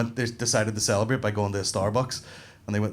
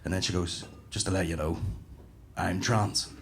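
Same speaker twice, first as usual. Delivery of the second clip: tag; 5 semitones lower, 5 dB quieter than usual. Retold or imitated.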